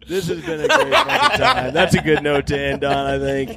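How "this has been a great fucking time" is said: laughing. Real, not acted. Laughing hard after joke.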